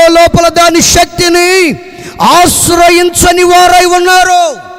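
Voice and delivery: loud, slow, ringing sermon cadence; preaching at full force. Acted. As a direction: pulpit preaching, stretched and loud.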